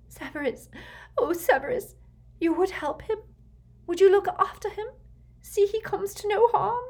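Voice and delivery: very high, imploring plea; desperate hope. A narrator's reading. Mother imploring Snape to look after Draco, desperately hopeful. Narcissa pleads with sudden hope.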